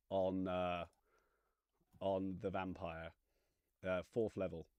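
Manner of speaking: ordinary talk, nothing marked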